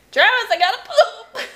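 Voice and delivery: put-on loud, high little-boy voice; high-pitched child impression, amused; Michael. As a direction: imitate a kid announcing it for all to hear. Voices child calling from bathroom stall.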